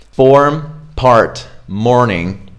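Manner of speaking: American English with clearly sounded R's; general American accent. Shown as a demonstration. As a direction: keep every R, American style. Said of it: "general American English".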